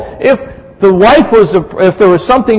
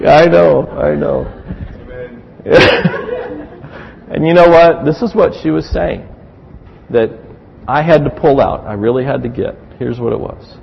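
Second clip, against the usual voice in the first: ordinary delivery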